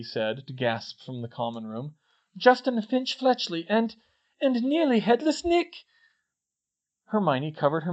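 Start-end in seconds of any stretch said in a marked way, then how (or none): 2.4-5.8 high, halting shocked report; falter, then end high in shock; alarmed announcement. Acted. Katie naming victims to the common room, alarmed.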